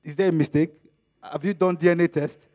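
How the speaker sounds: normal talking voice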